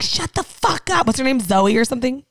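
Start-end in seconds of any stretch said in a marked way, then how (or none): 0.0-1.1 high, drawn-out mock scolding; mock irritation. Man who dislikes the dog, mock irritated scolding. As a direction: imitate his exasperation, high and slow. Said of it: "he doesn't like her".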